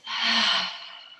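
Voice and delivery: long audible sigh out through the mouth; big exhaled sigh. Shown as a demonstration. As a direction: let a huge breath go, falling away. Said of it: "Exhale out through your mouth".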